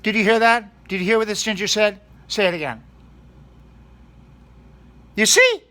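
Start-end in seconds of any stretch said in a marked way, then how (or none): 5.1-5.6 loud, much higher cry of vindication; triumphant exclamation. Acted. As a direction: shout it out as proof. Triumphant point to the audience.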